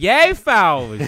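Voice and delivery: loud high mock Philly accent. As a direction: bellow it like a Philly fan. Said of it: "De Niro being like".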